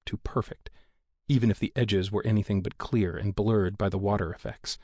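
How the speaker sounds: plain reading voice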